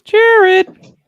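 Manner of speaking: very loud, high-pitched shout; calling out. Real, not acted. Host rousing a co-host who has not answered, shouting. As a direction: yell the name to rouse someone.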